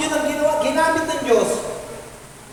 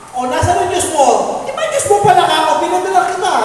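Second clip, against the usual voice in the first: taunting God voice; 6 semitones higher, 5 dB louder than usual. Retold or imitated.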